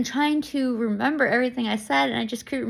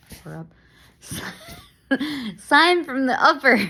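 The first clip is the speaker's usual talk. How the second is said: laughing through words; 3 semitones higher, 4 dB louder than usual. Real, not acted. Laughs while speaking.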